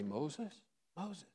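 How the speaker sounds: very soft, nearly whispered name, called twice; hushed call. Acted. Preacher imagines God calling Moses, hushed and very soft. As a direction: call the name gently under the voice. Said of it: "Or did he just say".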